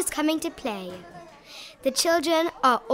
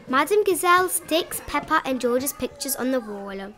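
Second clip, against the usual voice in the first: plain reading voice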